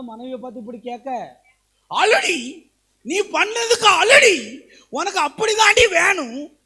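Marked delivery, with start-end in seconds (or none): 1.9-6.6 loud, high jeering shout with a laugh; husband jeering loudly at his weeping wife; mocking harsh husband. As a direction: gloat over her tears. Husband mocks crying wife, shouting.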